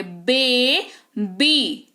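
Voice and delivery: बेबी very slowly as "bay... bee"; syllable demo of बेबी, "bay" then "bee"; two separated syllables. Shown as a demonstration. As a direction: split बेबी in two with a gap, each half drawn out. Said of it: "दो बार आपके lips".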